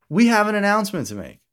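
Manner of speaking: high, sudden excited outburst; announcing big news. Real, not acted. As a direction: blurt it out, suddenly remembering.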